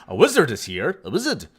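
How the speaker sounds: loud, delighted announcement; excited. Real, not acted. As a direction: blurt it out thrilled. Excited at finding wizard.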